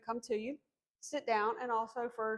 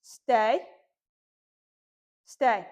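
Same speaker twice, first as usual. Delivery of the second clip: loud, firm "stay" to a puppy; slow, loud firm dog command; 8 dB louder than usual. Shown as a demonstration. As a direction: hold him in place with your voice. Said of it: "in a pretty firm tone".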